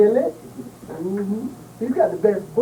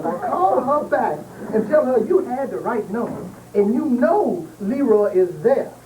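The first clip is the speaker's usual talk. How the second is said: normal talking voice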